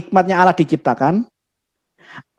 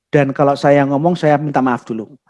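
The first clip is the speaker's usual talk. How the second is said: normal talking voice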